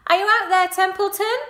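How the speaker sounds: high, loud call across the yard. Acted. Wilbur calling out across the wet yard. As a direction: call out to find the rat. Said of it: "called Wilbur".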